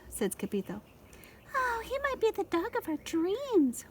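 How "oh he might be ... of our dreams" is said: high dreamy voice. A narrator's reading. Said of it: "whispered Rosalita".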